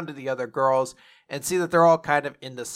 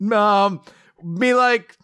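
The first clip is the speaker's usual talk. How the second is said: higher, louder mock broken English; 7 semitones higher, 5 dB louder, slower than usual. Imagining answering the interviewer, in higher mock broken English. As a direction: fumble through in bad English. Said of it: "I'd be like".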